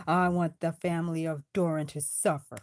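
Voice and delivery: villain vow. Acted. Villain vows revenge.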